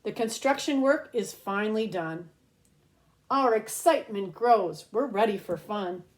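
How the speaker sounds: lilting read-aloud storybook voice; reading to children. Acted. Host reads a rhyming book to kids, storybook lilt. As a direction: read each rhyme slowly to little ones.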